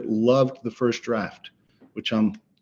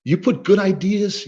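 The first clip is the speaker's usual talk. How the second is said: ordinary talk, nothing marked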